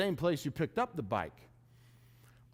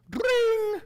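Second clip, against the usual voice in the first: high, louder bell ring imitation; imitated bike bell; 7 dB louder than usual. On the bike hill, hearing a rider behind, high bright bell ring. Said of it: "I hear, like, a, a bell".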